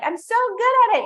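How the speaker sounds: high, loud, sing-song brag; a hammed-up show-off bragging; mock smug boaster. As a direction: parody someone showing off their meditation. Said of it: "people who are like".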